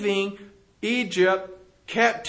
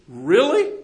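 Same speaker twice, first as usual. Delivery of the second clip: louder, doubting question; incredulous really; 7 dB louder than usual. Real, not acted. Preacher questioning the Israelites' wish to serve Egypt, loud incredulous challenge. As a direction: challenge it with disbelief.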